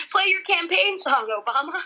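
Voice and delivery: high-pitched joking heckle; imitated heckler. Listener voices the drunk heckler, joking.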